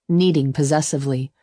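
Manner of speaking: usual speaking voice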